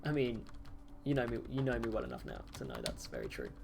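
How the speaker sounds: normal talking voice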